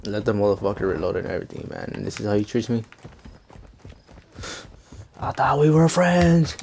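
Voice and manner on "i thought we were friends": slow, higher, hammed-up wounded voice; mock heartbreak over a spared enemy; mock hurt. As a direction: play the betrayed friend.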